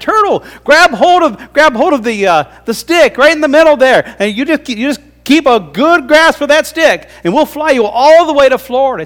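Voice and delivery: birds giving directions. Acted. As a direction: coax the turtle in a high bird voice. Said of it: "And they said".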